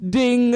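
high sung-out metallic ding; mock ding sound effect. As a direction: imitate a cheesy movie clang. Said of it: "it goes".

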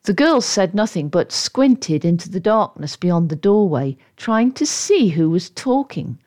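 usual speaking voice

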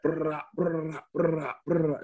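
high rolled gun-burst noise; imitated machine-gun fire. Soldier mimics his guns in a firefight, imitated machine-gun bursts. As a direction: trill out rapid bursts of gunfire. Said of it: "my guns are barking".

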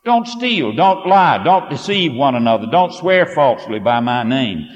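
loud, solemn preaching read of the law; scripture reading. Acted. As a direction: proclaim each command to the congregation. Preacher reads Leviticus aloud.

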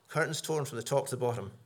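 ordinary delivery

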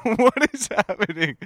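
high, slow, astonished; drawn-out mock disbelief at the crowd; stunned disbelief. Telling a friend how stunned he was in the liquor store. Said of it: "And I just was like".

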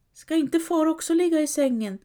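put-on high little child's voice; child's question. A narrator's reading. Annika asks her mother about father, high childlike question. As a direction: ask it high, like a small child.

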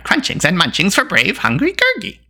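high hungry-creature voice; excited little beast, pitch climbing at the end; Gurgi crying eagerly. A narrator's reading. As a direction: beg greedily in a high beastly voice. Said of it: "Gurgi cried".

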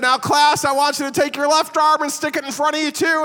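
mock squeaky, high-pitched little lecturer; high squeaky professor. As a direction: squeak it out like a scrawny, odd professor. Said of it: "he had this high squeaky voice".

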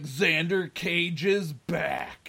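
slow, dramatic trailer announcer; movie trailer voice. Retold or imitated. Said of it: "I'm like".

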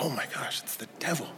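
mock-scared kids' whisper; hushed awe. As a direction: whisper it like an awestruck child. Awed kids react to devil's entrance.

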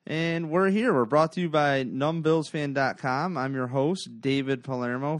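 usual speaking voice

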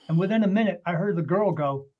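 ordinary talk, nothing marked

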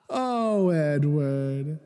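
ordinary delivery

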